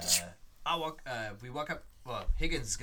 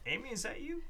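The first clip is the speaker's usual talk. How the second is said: surprised character voice. Acted. Player voices character, high.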